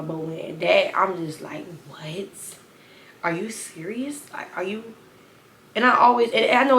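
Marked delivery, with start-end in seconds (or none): 1.9-4.9 soft, hushed what, are you serious; quiet incredulous whisper; stunned disbelief. Retold or imitated. Disbelief at his text.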